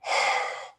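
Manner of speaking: audible deep inhale, demonstrated; deep breath in. Shown as a demonstration. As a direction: draw a big breath in so it is heard. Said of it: "big breaths in".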